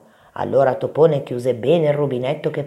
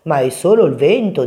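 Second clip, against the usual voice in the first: louder, matter-of-fact big mouse; dismissive reassurance; 2 semitones higher, 7 dB louder than usual. A narrator's reading. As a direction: brush off the little one's fear. (tono sbrigativo)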